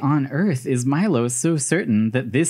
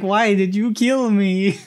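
high, drawn-out fake wail; hammed-up plaintive victim; mock murder victim; 7 semitones higher, slower than usual. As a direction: play the wronged ghost, slow and pitched up. Invented speech bubble of murdered grandpa.

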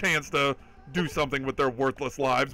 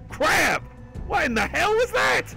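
shocked outburst; 14 semitones higher, slower than usual. Real, not acted. Surprise huge subs gift.

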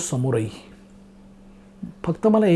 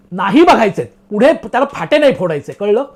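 louder, insistent mock telling-off; acted cross retort; imitated irritation; 4 dB louder than usual. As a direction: shut down the questioning as the annoyed man. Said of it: "तेही चिडले".

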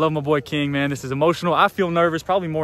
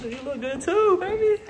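teasing. Pretend. Teasing friend in high voice.

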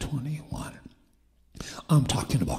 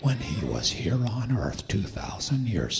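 full-force preaching; 4 dB louder than usual. Real, not acted. Preacher declaring healing to the church, bold and forceful. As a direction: proclaim it boldly, stretching key words.

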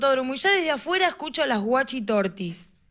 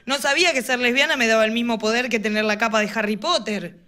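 ordinary talk, nothing marked

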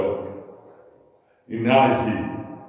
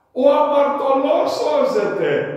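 high, loud ringing proclamation; emphatic proclaim; 12 semitones higher, 7 dB louder, slower than usual. Real, not acted. Preacher proclaiming to the faithful, loud and high. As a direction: cry it out to the congregation.

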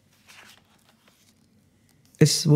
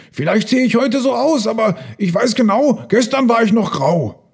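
high, insistent character voice; confused elephant; 11 semitones higher, 4 dB louder than usual. A narrator's reading. Repainted elephants answer each other, high bewildered voice.

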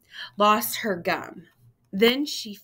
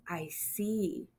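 soft breathy whisper; whispered I see; 8 dB quieter than usual. Acted. Grandma Rose seeing Simon's haircut, soft whisper. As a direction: hush it as if shocked. Grandma sees the chunk gone.

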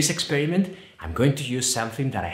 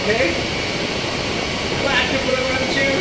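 talking loudly over a roaring hair dryer; raised voice over noise; 8 semitones higher, 4 dB louder than usual. Real, not acted. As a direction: push your voice over the noise, higher and louder.

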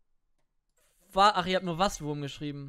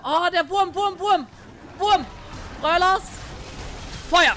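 loud, high panicked yell; excited shouting; 11 semitones higher, 7 dB louder, slower than usual. Real, not acted. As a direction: shout out in alarm. Panic in fight in game.

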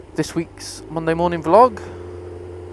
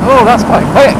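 high, loud burst of surprise; surprised exclaim; 7 semitones higher, 10 dB louder than usual. Real, not acted. Surprised by bike's speed.

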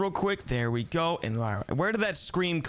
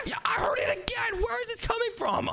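excited alarm. Acted. Hears scream in vent.

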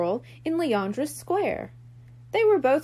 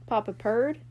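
ordinary delivery, nothing marked

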